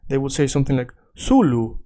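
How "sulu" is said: Swedish accent, both o's of solo as "oo"; Swedish-accented Spanish. Shown as a demonstration. Teacher imitating Swedish learners, Swedish-accented sulu. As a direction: say sulu, like a Swede reading solo. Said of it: "if you're a Swedish speaker".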